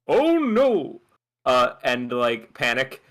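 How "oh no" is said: high, loud cry of alarm; panicked. Acted. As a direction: yelp it in sudden panic. Acting out character's panic.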